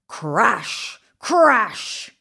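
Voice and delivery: low rumbling thunder crash; booming crash. A narrator's reading. Reading the storm to children, a booming crash. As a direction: boom it out like thunder.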